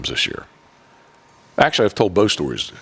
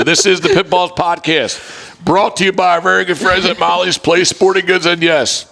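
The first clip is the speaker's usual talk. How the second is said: higher, slow, hyped show sign-off; podcast announcer; 11 semitones higher, 3 dB louder than usual. Acted. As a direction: announce the show like a host on air.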